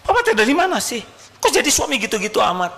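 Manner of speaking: high, shrill-free nagging wife voice, fast at the end; mock nagging wife; scolding wife. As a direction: play the wife telling him off. Voicing angry wife scolding husband.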